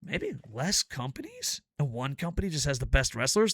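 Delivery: normal talking voice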